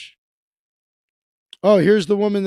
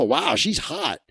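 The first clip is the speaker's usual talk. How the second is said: ordinary talk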